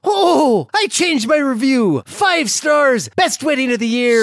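overjoyed reviewer gushing; excited. Acted. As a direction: rave about the wedding, thrilled.

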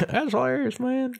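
very high mock gushing; hammed-up high-pitched approval; imitating amused onlookers. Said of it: "everyone's just like".

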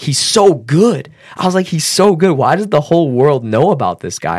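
high, emphatic enthusiasm; retold wonder, loud and high; amazed admiration. Retold amazed thoughts about Joe.

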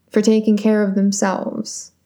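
ordinary delivery

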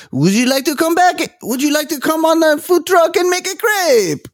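very high, hammed-up French accent; Frenchman's accent. Frenchman inviting contestants onto the food truck, exaggerated French accent. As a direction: sing out the invitation as a cartoon French vendor. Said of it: "the Frenchman's like".

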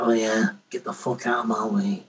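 low-pitched, deliberately bad Scottish accent. Shown as a demonstration. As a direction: put on a hammy Scottish accent. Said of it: "put on the really bad Scottish accent".

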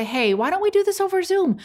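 high-pitched, casual proposal; breezy suggestion. Acted. Rehearses casual pitch to stylists.

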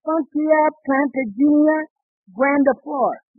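Funkia plantagenia grandiflora read slowly and pompously; mangled Latin name. Shown as a demonstration. Husband reading a plant catalog to his wife, slow and pompous. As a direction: sound out the long Latin name carefully. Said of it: "Look at this one right here".

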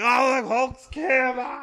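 high, slow gleeful squeal; gleeful mock voice. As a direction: squeal it out like a delighted kid. Avengers mocked in a card scene.

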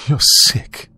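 high-pitched blunt accusation. A narrator's reading. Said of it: "I said bluntly".

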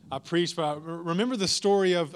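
ordinary talk

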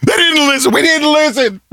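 very high cartoon voice; South Park quote. Quoting South Park to a co-host, very high cartoon voice. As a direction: squeak it like a cartoon kid. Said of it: "South Park reference".

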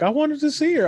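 put-on high whiny girl; whiny teen voice. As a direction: complain like a kid. Daughter quoted.

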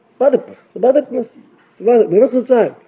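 high-pitched, bothered outburst; agitated complaint. Acted. Voices troubled person with nobody to tell.

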